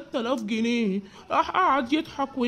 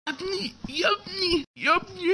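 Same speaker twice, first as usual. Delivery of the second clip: whimpering call; 5 semitones higher than usual. Acted. Beaten father calls son.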